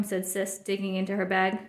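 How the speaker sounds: ordinary delivery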